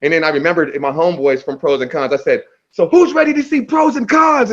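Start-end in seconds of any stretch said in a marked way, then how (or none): 2.7-4.5 high, projected hype-man call; rally the audience like an MC; hyping the crowd. Retold or imitated. Rapper introducing his homeboys to the crowd, excited call.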